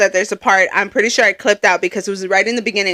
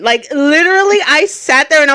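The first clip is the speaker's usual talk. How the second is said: raised, louder burst; a lively burst of emphasis; excited; 5 semitones higher, 5 dB louder than usual. Real, not acted. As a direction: pitch up and push it out. Excited retelling of creepy moment.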